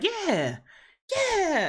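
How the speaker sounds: a mocking high "yeah, yeah" from the vampire. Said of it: "the vampire was like".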